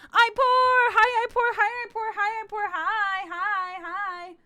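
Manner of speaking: loud, high, repeated excited hellos; high, loud welcome chant, tapering; excited greeting. Real, not acted. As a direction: shout a gleeful greeting, easing off as it repeats. Calling out greeting to arriving viewer.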